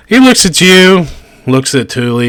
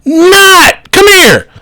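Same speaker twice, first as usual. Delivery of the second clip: high, loud call across the shop; yelling for helper; 6 dB louder, much slower than usual. Acted. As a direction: shout for the helper again. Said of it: "He yells out".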